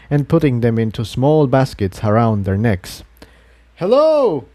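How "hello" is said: high, bright call down the hill; a friendly hail to strangers below. A narrator's reading. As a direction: call out a greeting from above. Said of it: "Jigsaw called out".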